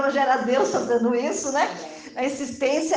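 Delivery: normal talking voice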